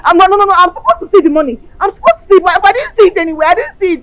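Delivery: loud, panicked protest about missing money; frantic shouting. Acted. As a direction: shout it out in disbelief, over and over. Frantic over missing hundred million.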